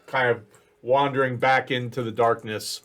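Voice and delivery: ordinary talk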